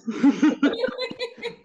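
giddy high-pitched laugh. Real, not acted. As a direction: break into a bright laugh. Host laughing at sign-off.

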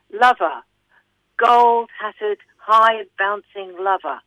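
loud, declaimed lover's call; crying out in verse. Acted. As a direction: call out each epithet, slow and ringing. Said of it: "till she cry".

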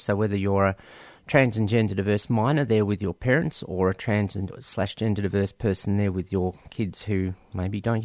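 ordinary talk, nothing marked

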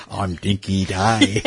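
slow imitation of her American accent; mock accented dinky-di. Host teases guest about her accent, mock accent. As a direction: echo the phrase in a teasing put-on American accent. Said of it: "dinky die with an accent".

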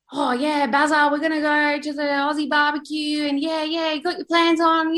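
imitating a cheery radio announcer; radio host. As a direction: pitch it like a breezy holiday radio presenter. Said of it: "on the radio, you hear".

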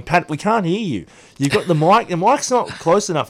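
listeners' complaint. Retold or imitated. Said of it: "everyone texting me going".

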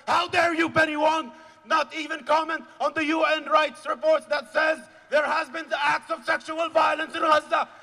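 high, shouted righteous outrage; furious denunciation. Real, not acted. Rally speaker rebuking government and Penny Wong, furious shouting.

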